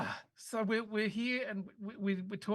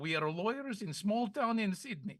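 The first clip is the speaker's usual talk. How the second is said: hammed-up Kazakh spy accent; comic foreigner, mock Kazakh. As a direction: badly fake a Kazakh accent, innocently denying it. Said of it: "you're a spy from Kazakhstan".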